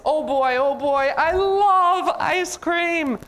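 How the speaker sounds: high, gleeful outburst, drawn out slowly; excited elephant. Acted. Elephant just handed his ice cream, very excited. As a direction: gush with overjoyed, stretched-out delight. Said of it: "look at how excited elephant is".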